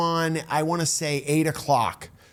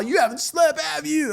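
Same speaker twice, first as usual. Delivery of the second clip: imitated laughing voice, high-pitched; high, teasing squeal as if amused; mock amused question, pitched way up; Jim; 8 semitones higher than usual. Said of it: "he answers the door laughing".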